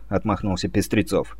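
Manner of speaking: plain reading voice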